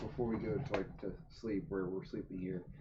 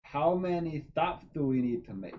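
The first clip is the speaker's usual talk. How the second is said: drawn out, raised voice; slow and deliberate; 5 semitones higher, 3 dB louder, slower than usual. Acted. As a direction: spell out each word slowly. Player asks slowly, deliberately.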